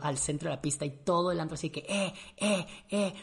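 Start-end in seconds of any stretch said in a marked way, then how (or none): 1.9-3.1 mock crowd cheer, slightly raised; imitated crowd shouting. Dance floor crowd cheering on the brothers, mock shouting. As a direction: chant it like a club crowd. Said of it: "uy, gritándonos".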